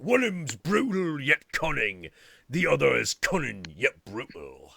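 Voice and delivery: put-on broad, uneducated Australian orc accent; outback Aussie orc. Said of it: "uneducated outback Aussies".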